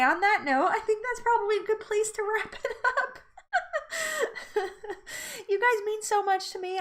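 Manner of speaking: real high, laughing through tears; can barely speak for laughing and crying; teary laughter. Real, not acted. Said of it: "I can't even talk now".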